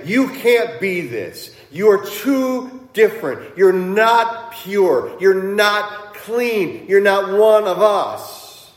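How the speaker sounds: loud, high, emphatic accusation; accusing rejection. Retold or imitated. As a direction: drive each rejection home slowly and forcefully. Acting out the accusers rejecting Jesus.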